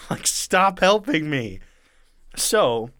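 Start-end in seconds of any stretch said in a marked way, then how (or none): 0.1-1.6 slow, higher-pitched protest; exasperated mock plea. [name] begging helpers to back off, mock exasperation. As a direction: drag it out, begging them to quit.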